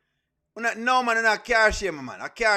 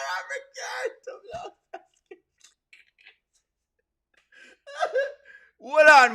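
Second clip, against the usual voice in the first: laughing fit; 11 semitones higher, much slower than usual. Real, not acted. Cracking up at chat comment.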